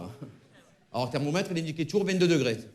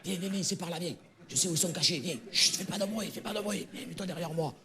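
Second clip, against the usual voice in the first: whispering while hiding; 6 dB quieter than usual. Acted. Sneaking into the port.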